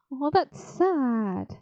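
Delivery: slow, drawn-out mock pity; milk the sadness with a slow sigh; mock sad. Reacting to the sad story moment, slow mock sorrow.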